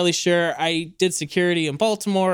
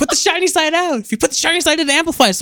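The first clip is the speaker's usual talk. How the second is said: laughing retold quote; 11 semitones higher, 3 dB louder than usual. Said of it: "He goes".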